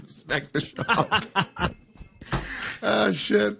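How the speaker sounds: real laughter through slow words; laughing. Real, not acted. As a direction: repeat it slowly while cracking up. Laughs while repeating the joke.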